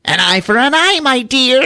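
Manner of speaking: high, theatrical villain voice; gloating leprechaun. Acted.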